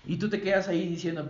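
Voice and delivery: usual speaking voice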